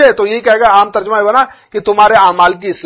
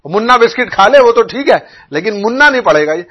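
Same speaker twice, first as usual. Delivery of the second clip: ordinary talk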